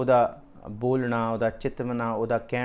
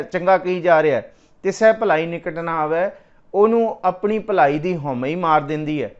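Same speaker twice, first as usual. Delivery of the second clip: raised, ringing preacher's voice, driving each verse home; fervent preaching; 4 semitones higher, 6 dB louder than usual. Acted. Preacher to the congregation on ego, fervent preaching. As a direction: preach it out to the whole hall with full force. Katha preaching at full force.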